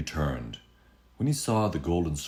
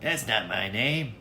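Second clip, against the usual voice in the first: higher, slow teasing little man; smug imp; 6 semitones higher than usual. Acted. Little man teases the queen, slow and smug. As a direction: savour the refusal. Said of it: "smiled and said".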